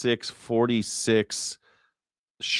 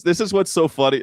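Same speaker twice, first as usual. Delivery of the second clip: ordinary delivery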